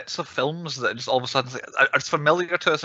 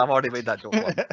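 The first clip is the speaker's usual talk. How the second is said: ordinary talk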